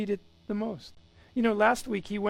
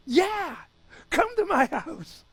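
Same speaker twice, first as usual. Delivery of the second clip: high, loud burst of welcome; excited; 9 semitones higher, 4 dB louder, slower than usual. Retold or imitated. Zacchaeus calling Jesus in excitedly. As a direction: shout the invitation with joy. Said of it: "He's excited".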